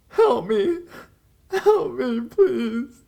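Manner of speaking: high, loud, desperate cry for help; screaming for help. Retold or imitated. Terrified girl on speakerphone begging strangers, screaming and crying. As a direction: shriek it like a girl in terror on the phone. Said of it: "this girl was seriously crying and screaming".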